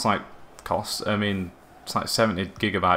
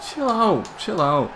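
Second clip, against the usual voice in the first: high-pitched plea to ease off; agitated protest; 9 semitones higher, much slower than usual. Real, not acted. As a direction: start high, drop on the repeat. Protesting at the game's shots.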